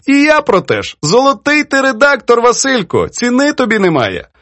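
put-on high-pitched, playful reproach from an elderly poetess; teasing old poetess. Poetess chides her young editor over his edits, teasing. Poetess praises, then challenges.

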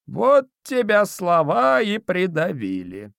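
put-on high, slow, snorting old spirit; snorting domovoi. A narrator's reading. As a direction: snort it out, squeaky and mocking. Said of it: "фыркнул домовой".

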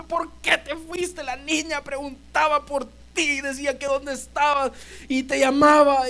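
high, tearful reproach; grieving mother. Acted. Mother scolding Jairo for leaving, tearful high wail. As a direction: cry it out at your husband.

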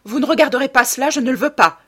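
loud, high and rushed; frantic, forceful outburst; panicked refusal. A narrator's reading. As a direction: forbid it in sudden terror. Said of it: "dit-il en pâlissant".

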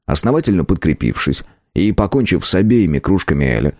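ordinary delivery

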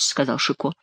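ordinary delivery

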